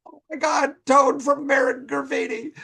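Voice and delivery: starstruck. Real, not acted. Starstruck about the director.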